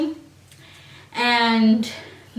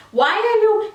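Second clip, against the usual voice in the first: heated retort; 10 semitones higher, 3 dB louder than usual. Retold or imitated. Heated classroom fight, retold.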